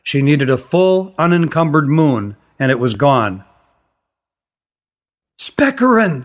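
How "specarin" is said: high call into the trees; girl shouting for the magician in the woods. A narrator's reading. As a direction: call out his name, high. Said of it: "she shouted into the trees".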